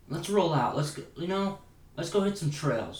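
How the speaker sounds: rally friends in an acted quote; playful let's-go enthusiasm; rallying the bros. Acts out rallying his friends.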